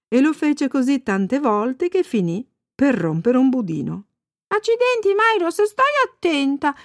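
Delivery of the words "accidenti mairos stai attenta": put-on very high little girl telling off; Annika. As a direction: scold the cow in a child's high voice. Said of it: "disse Annika dandole uno schiaffetto". (bambina che sgrida con voce acuta)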